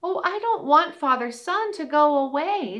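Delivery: high, pleading mouse voice; little mouse voice. Acted. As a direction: whimper like a tiny mouse. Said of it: "said the little mouse".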